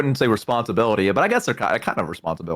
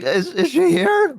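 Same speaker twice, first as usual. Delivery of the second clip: high, slow, timid question; nervous kid voice; much slower than usual. Retold or imitated.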